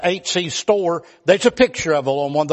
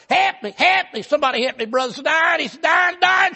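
high, frantic hollering for help; 8 semitones higher than usual. Retold or imitated. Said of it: "he was a hollering".

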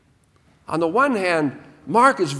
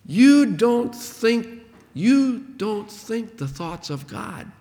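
slow stern rebuke; 3 semitones higher, much slower than usual. Retold or imitated. Voicing Jesus rebuking Peter.

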